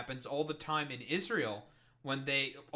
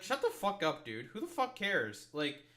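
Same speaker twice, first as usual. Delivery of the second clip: normal talking voice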